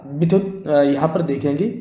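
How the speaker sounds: ordinary talk, nothing marked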